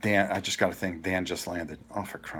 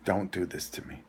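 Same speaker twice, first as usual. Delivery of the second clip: pleading prayer; 2 semitones lower, 7 dB quieter than usual. Retold or imitated. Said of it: "I go".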